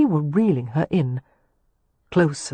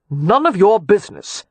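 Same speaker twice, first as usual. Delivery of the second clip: curt; 3 semitones higher, 4 dB louder than usual. A narrator's reading. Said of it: "Leo said curtly".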